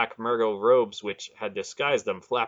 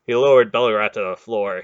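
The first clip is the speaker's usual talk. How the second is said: usual speaking voice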